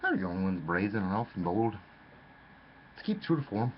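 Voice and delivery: usual speaking voice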